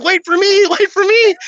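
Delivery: high-pitched, pleading call after runaways; mock panicked call. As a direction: call after fleeing friends, voice climbing high. Calling after fleeing friends, retold.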